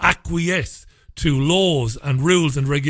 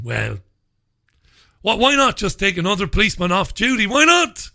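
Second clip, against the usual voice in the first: usual speaking voice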